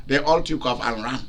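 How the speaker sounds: usual speaking voice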